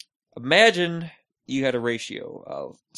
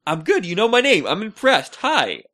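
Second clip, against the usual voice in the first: higher, louder acted answer; retold wary reply; 6 semitones higher, 6 dB louder than usual. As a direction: answer a stranger's greeting, acted out. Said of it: "And I'm like".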